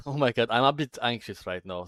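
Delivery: jittery nerves pushing the voice up; anxious. Real, not acted. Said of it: "That's why I'm talking like this".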